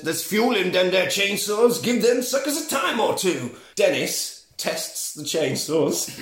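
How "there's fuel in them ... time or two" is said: lightly voiced country shop keeper, pitched up; drawled pitch from an old storekeeper; old shop guy. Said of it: "and the guy says".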